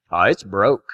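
deep, offhand doctor's voice; doctor's low verdict. Retold or imitated. Doctor in the hallway announcing the break, deep and offhand. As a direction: drop it low like a matter-of-fact country doctor. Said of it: "I hear Doctor Nunley out in the hallway say".

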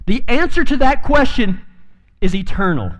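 "The answer to that question is eternal" said high and ringing; high first take. Shown as a demonstration. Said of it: "repeated lower".

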